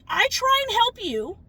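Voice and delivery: loud, high-pitched real anger building to Karen; furious rant. Real, not acted. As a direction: let her have it, fed up and shouting. Ranting at the woman who scratched car.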